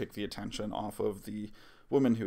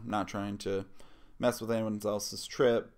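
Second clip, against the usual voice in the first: usual speaking voice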